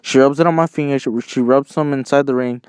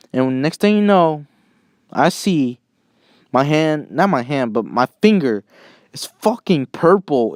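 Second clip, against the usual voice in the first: usual speaking voice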